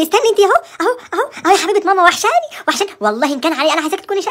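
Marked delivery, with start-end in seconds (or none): 0.0-2.9 cooing mother. Acted. Mother cooing to daughter on call.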